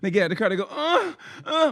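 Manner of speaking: high, squeaky startled oh; mock shocked kids. The other lady's kids startled after the crash, high oh. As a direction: gasp it like scared kids. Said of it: "they were like".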